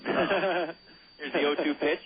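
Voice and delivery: slow, lightly put-on Scottish lilt on the pitch call; put-on Scottish accent. Announcer calling the pitch in a mock Scottish accent. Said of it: "I'm not gonna do the accent there".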